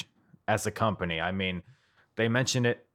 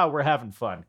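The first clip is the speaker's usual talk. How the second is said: ordinary talk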